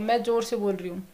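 ordinary talk, nothing marked

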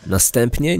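ordinary talk, nothing marked

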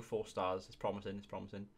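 usual speaking voice

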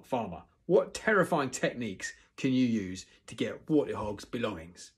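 loud, commanding instructor; yelling teacher. A narrator's reading. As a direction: bark out the role-play orders. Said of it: "yelled Gobber".

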